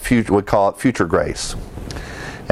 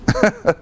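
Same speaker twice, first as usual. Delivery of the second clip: short laugh; 12 semitones higher than usual. Real, not acted. Laughs at his own quip.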